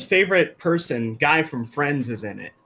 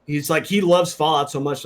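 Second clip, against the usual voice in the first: ordinary talk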